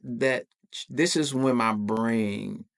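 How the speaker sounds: ordinary talk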